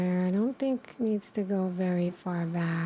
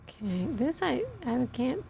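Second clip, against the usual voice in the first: ordinary delivery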